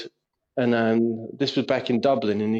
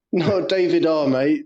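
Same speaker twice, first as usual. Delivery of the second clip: ordinary talk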